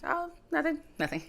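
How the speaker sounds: nervous brush-off. Retold or imitated. Covering up worry.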